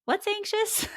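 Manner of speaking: put-on high, puzzled little-kid question; child's voice. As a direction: ask it like a small child. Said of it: "And they go".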